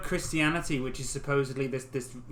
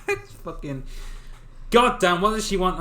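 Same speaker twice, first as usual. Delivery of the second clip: loud, higher fake rant; mock angry outburst; 4 semitones higher, 5 dB louder than usual. Imitating Carl fuming over the new Pope, loud. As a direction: rant like a fed-up fan.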